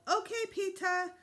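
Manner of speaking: little brother calling from the toilet. A narrator's reading. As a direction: call from the bathroom like a small boy. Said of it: "Fudge called".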